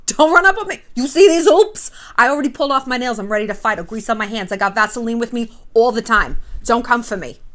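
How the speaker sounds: brash, loud-starting Staten Island Italian threat; mock tough-girl warning in an Italian-American accent; put-on Staten Island Italian, squaring up for a fight. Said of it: "that accent lapsed into Italian".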